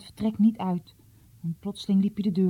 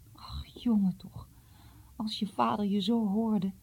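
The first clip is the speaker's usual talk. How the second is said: soft, breath-laden lament; tearful; 2 semitones higher, 5 dB quieter than usual. A narrator's reading. Distraught mother fearing father hears Jom, tearful lament. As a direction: sigh it out, close to sobbing. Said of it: "Moeder snikte hartstochtelijk".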